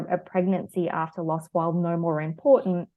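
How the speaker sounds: normal talking voice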